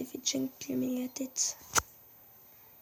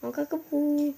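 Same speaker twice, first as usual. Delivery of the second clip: put-on higher, louder baby babble; cutesy baby talk; 4 semitones higher, 5 dB louder than usual. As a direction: squeal it like a toddler. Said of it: "parler comme un bébé".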